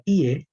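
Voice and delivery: "Iye" said high and loud, Betawi style; "Iye" in a loud Betawi drawl. Shown as a demonstration. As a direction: punch out "Iye" like a Betawi local. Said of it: "orang Betawi kan bilang".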